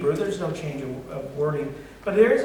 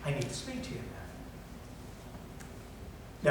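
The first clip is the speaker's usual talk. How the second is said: gentle voice of God; 2 semitones lower, 13 dB quieter, faster than usual. Acted. God speaking to Elijah at the cave, a soft gentle call. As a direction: call his name gently, almost under the breath. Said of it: "came a gentle whisper".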